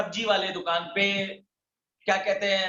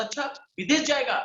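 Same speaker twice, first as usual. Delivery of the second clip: higher-pitched, sceptical question; mock-doubting taunt; 6 semitones higher, faster than usual. People taunting him about going abroad, imitated sceptical question. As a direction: imitate a scoffer asking in disbelief. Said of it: "ऐसी-ऐसी ताने देते थे".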